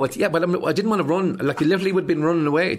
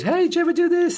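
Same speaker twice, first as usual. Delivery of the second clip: put-on high, louder playground kid; 5 dB louder than usual. Remembering kids' tricks, high childish call. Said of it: "when you're a kid".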